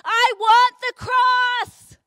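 loud, high, drawn-out rallying shout. Retold or imitated. Speaker leads an arena of teenagers, full-voiced shout. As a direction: shout it slowly across a packed arena. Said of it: "I want you to shout".

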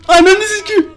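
high, loud frightened curse; startled scream. Real, not acted. Player startled by the monster, high scared yell. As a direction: yelp it out in real fright.

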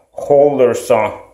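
ordinary talk, nothing marked

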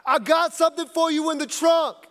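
menacing threat. Retold or imitated. Angry stranger threatening the coach, tough-guy voice. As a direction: lean in and threaten him. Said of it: "this guy looks at me and goes".

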